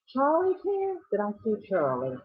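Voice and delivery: usual speaking voice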